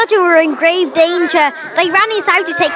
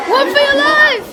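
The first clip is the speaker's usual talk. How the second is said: acted fright; 10 semitones higher than usual. Acted escape from dinosaur.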